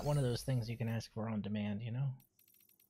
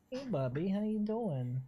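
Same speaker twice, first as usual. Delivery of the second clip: pet talk; 6 semitones higher than usual. Real, not acted. Greeting the pet cat.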